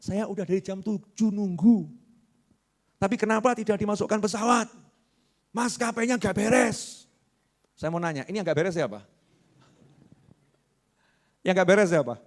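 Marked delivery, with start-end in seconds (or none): 0.0-7.1 lightly voiced complaining passenger; mock angry passenger. Passenger complaining at the gate, lightly voiced mock grumble. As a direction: grumble like a fed-up traveller. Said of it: "terus teriak-teriak".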